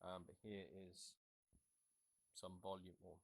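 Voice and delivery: distant, muffled sound coming through the headset speakers; heard through helmet speakers. Real, not acted. Rider testing the intercom audio for viewers, through the helmet speakers. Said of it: "some audio through the actual speakers".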